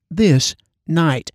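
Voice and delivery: "this" and "night" set apart and stressed; emphasis on "this night". Shown as a demonstration. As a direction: land on THIS, pause, then NIGHT. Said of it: "Emphasis on the words".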